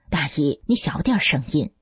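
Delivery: usual speaking voice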